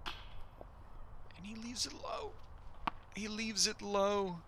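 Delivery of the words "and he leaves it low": hushed, slow golf commentator call; drawn-out hushed call of the putt. Acted.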